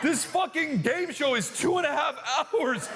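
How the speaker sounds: high-pitched comic exasperation; hammed-up incredulous outburst; exasperated shout. Exasperated at endless mock game show.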